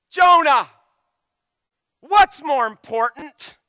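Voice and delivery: high, loud, slowed rebuke as God; rebuking shout. Acted. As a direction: thunder the question at Jonah. God voiced rebuking Jonah.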